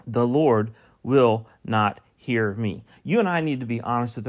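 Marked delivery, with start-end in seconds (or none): 0.1-2.8 "the Lord will not hear me" slow and loud, each word separate; emphatic word by word. Real, not acted. Preacher warning the congregation from the verse, slow, loud, word-by-word emphasis. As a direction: hammer "will", "not", "hear" with pauses between.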